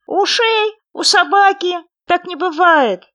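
high, loud, drawn-out retort; loud playful argument; calling out. A narrator's reading. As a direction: shout it slowly, word by word. Playful shouting match.

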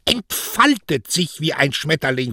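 high-pitched, irritated correction; snapping indignantly. Acted. Daughter corrects her mother's misquote of his words, snapping. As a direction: correct her with a sharp flare of temper. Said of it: "fauchte Beatrice".

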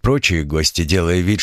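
plain reading voice

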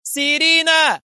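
very high, loud call of a name; shout. A narrator's reading. Assistant screaming for Serena to come, loud. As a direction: yell across the cellblock for her enforcer. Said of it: "ثم صرخت".

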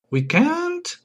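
high rising question; dismayed surprise. Acted. Guest hears the interview cannot proceed, dismayed surprise. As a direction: squeak it upward in disbelief.

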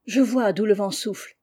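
plain reading voice

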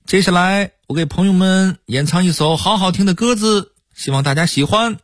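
very high Hong Kong-Taiwan pop singer patter; Hong Kong-Taiwan accent. Retold or imitated. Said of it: "喜欢用港台腔儿说话".

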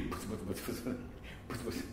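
fast, soft mumbled muttering under the breath; imitating grumbling. Preacher mimics a grumbling person, mumbling fast and soft. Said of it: "ブツブツ言うな".

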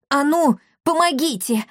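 puffing with effort; strained, pushing hard; straining puff. Acted. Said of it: "пропыхтел он".

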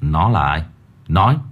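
stern demand. A narrator's reading. Master demands an answer.

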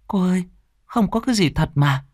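put-on high, anxious young maid; high servant voice, worried; servant girl voice. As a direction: fret over her fallen mistress.

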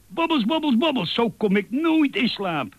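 heel hoge, opgewonden uilenkreet; paniekerig geschreeuw. A narrator's reading. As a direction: jammer het hoog uit, in paniek. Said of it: "schreeuwde Uil". (hoog, wanhopig schreeuwen)

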